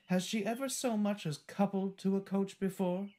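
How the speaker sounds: high, haughty doubt; sniffy. A narrator's reading. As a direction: sniff at her lack of experience. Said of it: "sniffed Annie".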